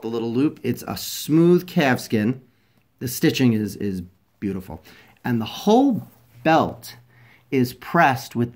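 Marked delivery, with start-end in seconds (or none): none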